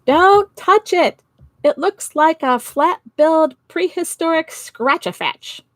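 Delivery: science teacher shouting at the crowd, loud and high. Acted. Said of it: "and he shouted".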